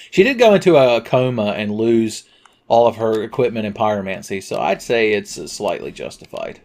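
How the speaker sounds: ordinary talk, nothing marked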